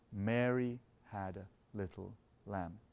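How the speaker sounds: "Mary" sung out heavy, rest lighter and slow; slow, stress on MARY. Shown as a demonstration. Conductor sings the phrase while beating heavy on Mary. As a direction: sing it slowly, weight on "Mary". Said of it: "giving less on the following beats".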